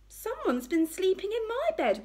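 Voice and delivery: high-pitched indignant mother bear; affronted, high bear voice; Mummy Bear. A narrator's reading. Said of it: "rumbled Mummy Bear".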